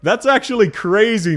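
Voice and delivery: shocked. Real, not acted. Shocked by the account's purchases.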